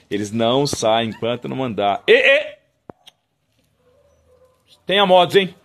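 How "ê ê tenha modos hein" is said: loud high scolding. Real, not acted. Owner scolding unruly dogs, loud scold. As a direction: tell the dogs off.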